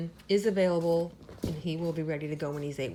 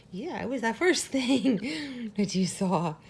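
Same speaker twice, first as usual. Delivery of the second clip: slow, higher cooing with a laugh in it; 5 semitones higher, slower than usual. Real, not acted. Owner laughing as she coos to the puppy who just opened his eyes. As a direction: coo tenderly and giggle mid-phrase.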